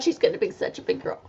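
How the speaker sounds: ordinary talk, nothing marked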